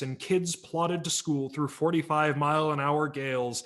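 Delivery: plain reading voice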